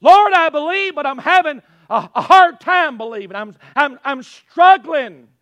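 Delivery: loud cry, stumbling into confession; impassioned plea. Acted. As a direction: plead loudly, tripping over the struggle. Impassioned repeat of struggling prayer.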